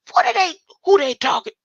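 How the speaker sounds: high, loud, fast outburst; high indignant rant at the verse; outraged disbelief. Real, not acted. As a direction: fire it off in shock.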